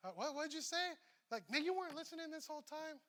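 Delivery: wife asks why her husband wasn't listening, mock irritation; mock annoyed wife. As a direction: play the wife who caught him not listening. Wife scolding inattentive husband.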